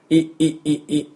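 イ clipped short from the throat; throaty i. Shown as a demonstration. Teacher drilling the throaty イ sound. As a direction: catch イ in the back of the throat. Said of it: "喉の奥で".

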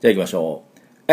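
normal talking voice

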